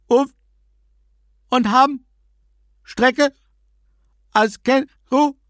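high loud broken-up phone shouting; shouting on bad phone line. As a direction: yell fragments over a bad connection. Nolven's garbled voice on phone.

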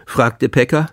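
ordinary delivery, nothing marked

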